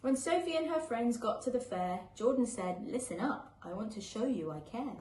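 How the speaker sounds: gentle, lilting picture-book read-aloud; reading to children. A narrator's reading. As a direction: read it like a bedtime story for little ones.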